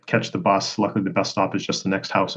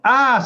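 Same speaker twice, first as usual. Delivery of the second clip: high-pitched mock scream; playful cry of alarm; mock panicked scream; 14 semitones higher, 4 dB louder than usual. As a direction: imitate a startled yelp, pitched way up. Retold panic as moose charges.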